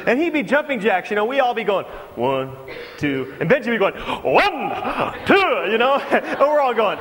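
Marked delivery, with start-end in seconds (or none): 2.1-3.3 low, lazy half-hearted counting; bored count. Retold or imitated. Kids doing lazy jumping jacks. 4.2-5.7 eager count. Retold or imitated. Eager Benji counting jumping jacks.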